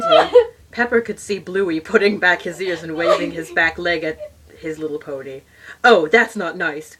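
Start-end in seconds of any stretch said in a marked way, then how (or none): 5.8-7.0 gasp. Acted. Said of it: "gasped Pepper".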